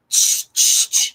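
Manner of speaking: loud unvoiced shh sounds; shushing. Retelling the night noise with a loud shush.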